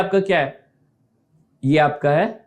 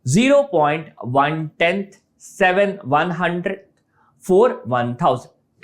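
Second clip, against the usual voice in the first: slow recap. Shown as a demonstration. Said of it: "clear है?".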